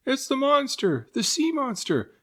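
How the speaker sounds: very high, loud panicked shriek; terrified cries. A narrator's reading. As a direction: scream in terror. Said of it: "cries of terror".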